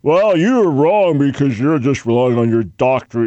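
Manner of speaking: a hint of a sneering accuser; mock critic. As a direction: parody a critic who scoffs at degrees. Mocking a critic.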